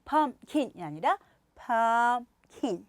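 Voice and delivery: pumpkin slowly in two beats, PUMP-kin; pumpkin, PUMP long, kin short; slow two-syllable pumpkin. Shown as a demonstration. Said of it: "이음절로 하시면 돼요".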